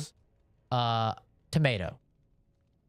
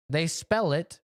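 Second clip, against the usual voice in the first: usual speaking voice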